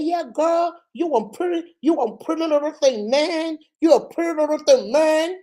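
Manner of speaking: slightly high fake American swagger; imitated American slang accent; mock American accent. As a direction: lay on the American accent to impress. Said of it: "you can speak American accent".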